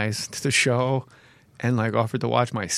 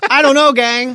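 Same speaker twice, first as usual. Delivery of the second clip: put-on high, loud kid-star voice; precocious child actor; 11 semitones higher, 9 dB louder than usual. Comedian pitching a kid actor to the others, precocious child voice. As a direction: belt it out like a hammed-up child star. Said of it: "precocious Shirley Temple".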